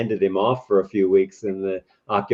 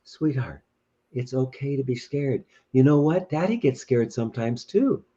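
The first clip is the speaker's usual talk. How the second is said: comforting daddy; 2 semitones higher than usual. Acted. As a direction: gently soothe a scared little one. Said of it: "picks the child up and says".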